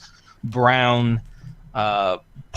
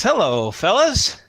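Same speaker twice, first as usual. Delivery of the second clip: high, grinning, salesy welcome with a laugh; fast-talking friendly broker putting guests at ease; slick broker greeting; 10 semitones higher than usual. Acted. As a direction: schmooze the new clients like a shifty dealer. Broker greets players with grin.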